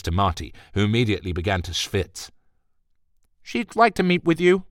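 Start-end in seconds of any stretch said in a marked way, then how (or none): 3.4-4.7 high, tight clipped secretary; a tight-throated office assistant; pinched assistant voice. Acted.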